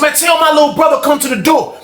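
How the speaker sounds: high loud demand; shouting at the door. Retold or imitated. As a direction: yell for his brother. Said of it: "He said".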